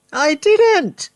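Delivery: put-on high, loud old-woman cackle; Grandma cackles, high and loud; cackling Grandma. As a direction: cackle it out gleefully. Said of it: "cackled the old woman".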